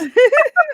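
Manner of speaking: loud squealing laugh; high burst of laughter. Real, not acted. Telling friends about the long walk home, laughing.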